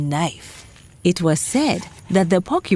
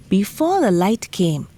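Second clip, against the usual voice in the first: usual speaking voice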